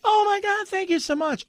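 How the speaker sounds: high-pitched, gushing mock gratitude; gushing thanks. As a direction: pretend to be the delighted gift recipient, squealing thanks. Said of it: "And they're like".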